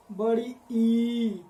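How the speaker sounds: ई held very long and slow; drawn-out long vowel. Shown as a demonstration. Teacher drawing out बड़ी ई, very slow. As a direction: stretch the ई to show its length. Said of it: "बोल के देख लीजिए आप लोग".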